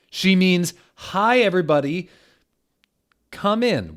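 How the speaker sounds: high, loud mock headmaster voice; hammed-up headmaster. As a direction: announce it brightly over her. Dumbledore corrects her greeting.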